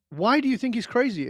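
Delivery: high-pitched retold question; puzzled challenge. Man asking his training partner for reasons, puzzled. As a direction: press him, pitched well up.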